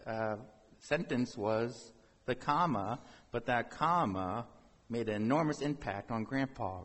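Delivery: ordinary delivery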